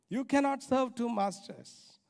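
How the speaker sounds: ordinary talk, nothing marked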